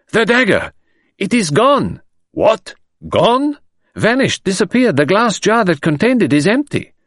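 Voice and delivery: high, loud and urgent; high-pitched alarmed announcement; alarmed report. Acted. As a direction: blurt out the bad news. Commissary reports stolen dagger.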